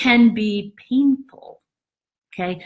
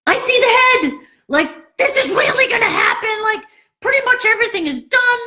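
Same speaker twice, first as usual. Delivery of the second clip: much higher, laughing excitement; excited; 14 semitones higher than usual. Retold or imitated. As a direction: cry it out like the head just appeared. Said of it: "kind of like".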